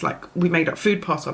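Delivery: ordinary talk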